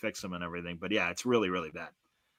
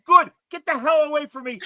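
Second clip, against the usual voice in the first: much higher, louder mock shout; retold yell back; 14 semitones higher, 7 dB louder than usual. Speaker shouts back at the departing guy, retold loud yell. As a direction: yell right back at him. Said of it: "And I'm screaming back at him".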